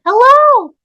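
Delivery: very high, loud squeaky mimic; toy echo. Toy mimics the calling woman, squeaky echo. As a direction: squeak it back like a talking toy.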